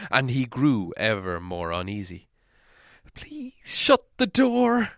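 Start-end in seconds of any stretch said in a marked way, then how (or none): 3.1-4.9 high, slow, pleading plea; begging. A narrator's reading. Bilbo begging the dwarves, high and pleading. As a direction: beg them to shut it, frightened. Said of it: "he begged them".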